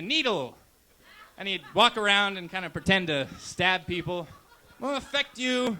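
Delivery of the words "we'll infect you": mock-menacing mascot, laughing; hammed-up playful threat, laughing through it. Comic voicing a needle mascot threatening the crowd in mock menace.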